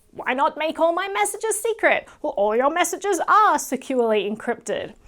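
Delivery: mocking a slippery corporate reply; mocking paraphrase. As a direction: parody their evasive FAQ answer. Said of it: "Telegram's answer incredibly dishonest".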